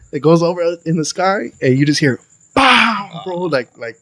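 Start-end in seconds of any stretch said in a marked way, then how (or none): 2.5-3.2 high "pow" for a crash; imitated bang. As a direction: mimic a bang on a metal roof. Imitates bang on metal awning.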